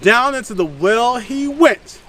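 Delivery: ordinary delivery, nothing marked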